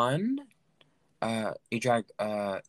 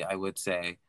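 usual speaking voice

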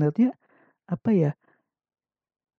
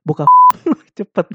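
laughing; 13 semitones higher, 11 dB louder, faster than usual. Real, not acted. Laughing while urging.

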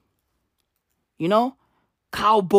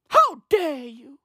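Greta impression; 5 semitones higher, slower than usual. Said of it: "Greta Thunberg would be proud".

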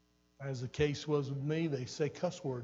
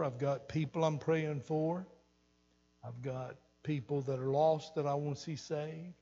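normal talking voice

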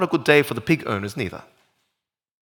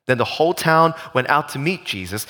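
ordinary talk

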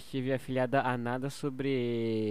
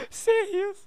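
laughing through the words; can't keep a straight face; stifled giggles. Real, not acted. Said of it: "Eu não consigo ficar sério".

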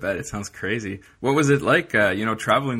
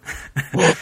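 short bright burst of laughter, higher and louder; 8 semitones higher, 7 dB louder than usual. Real, not acted. Host laughing at the guest's surf trip joke, loud laugh.

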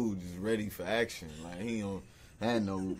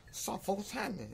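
pitched way up, mocking a bassless voice; voice with no bass; 10 semitones higher, 6 dB quieter than usual. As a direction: squeeze the bass out, go high and mocking. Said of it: "He lost his bass in his voice".

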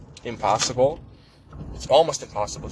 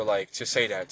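normal talking voice

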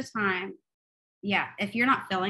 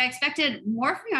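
mock complaint; 6 semitones higher than usual. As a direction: say "I expected more from you" as a playful reproach. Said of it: "I was like".

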